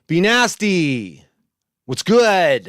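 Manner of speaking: high, loud, drawn-out greeting; big stretched-out shout-out; hyped shout-out. Real, not acted. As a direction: holler hello to a viewer. Calling out greeting to a viewer.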